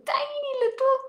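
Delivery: squeaky high tiny little voice; tiny voice for a tiny feeling. Shown as a demonstration. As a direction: make it sound very small.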